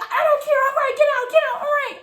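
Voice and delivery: very high, loud mimicry; frantic accuser. Accuser orders teller out, mimicked high and frantic. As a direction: squeal out a mock protest.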